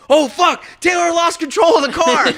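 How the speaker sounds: high-pitched, loud retold panic; alarmed outcry. Driver seeing a Tesla come at him, alarmed yell. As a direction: yell it as if reliving the shock. Retelling his alarm as car approaches.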